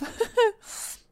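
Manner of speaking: brief self-mocking giggle; quick high giggle; short high laugh. Real, not acted. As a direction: laugh at your own blunder. Laughs at herself.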